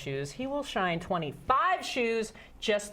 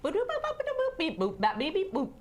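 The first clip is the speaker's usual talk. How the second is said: gibberish babble; 7 semitones higher than usual. Acted. Translator answers in gibberish.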